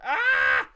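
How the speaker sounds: a sudden high, loud yelp. Real, not acted. Streamer hitting a payment snag, high yelp. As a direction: cry out in alarm.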